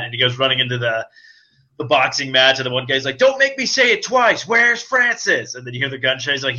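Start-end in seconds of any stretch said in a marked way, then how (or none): 3.2-5.5 higher, slow menacing taunt; mock thug. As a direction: lean on each word as a threat. Imitating thug at boxing match.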